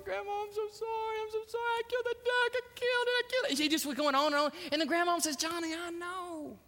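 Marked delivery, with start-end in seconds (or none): 0.0-3.6 high, wailing hammed-up blubber; mock tearful confession. Johnny confesses to Grandma, mock tearful wail. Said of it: "trembling and big old tears". 5.4-6.6 slow, higher, soft reassurance; gentle grandma. Acted. Grandma answers crying Johnny, gentle and knowing.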